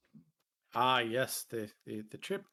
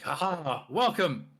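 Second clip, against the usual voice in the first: jovial minotaur boss; 7 semitones higher, 5 dB louder than usual. Acted. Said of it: "He's like".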